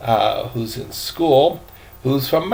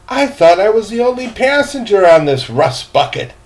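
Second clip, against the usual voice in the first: put-on high, louder boy's voice; eager boy; 9 semitones higher, 7 dB louder than usual. Boy telling the gentleman about the captain, high and eager. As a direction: play the cheerful young deckhand. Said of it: "said the boy".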